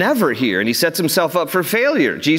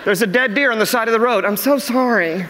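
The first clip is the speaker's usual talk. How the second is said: apologetic ladies; 4 semitones higher than usual. Retold or imitated. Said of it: "You're just".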